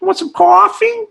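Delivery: put-on loud, high-pitched Brooklyn-style New Orleans accent. Imitating Southern fried Italians of New Orleans, thick Brooklyn-like accent. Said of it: "sound like they're from frickin' Brooklyn".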